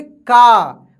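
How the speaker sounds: का drawn out loud with a falling aa; long aa sound. Shown as a demonstration. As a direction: hold the aa in का and let it fall. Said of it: "आ का उच्चारण होने लगेगा".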